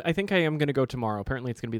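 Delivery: ordinary talk, nothing marked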